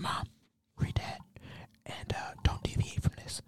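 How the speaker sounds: secretive whispered instruction; whispering. Retold or imitated. Son slips his mom a written note, whispering. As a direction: whisper it so the courtroom won't hear. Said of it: "So I'm, I'm just whispering".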